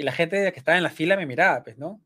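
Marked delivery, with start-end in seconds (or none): none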